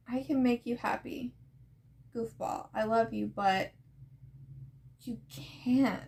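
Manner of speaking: acted comic reading. Reads comic dialogue, voicing characters.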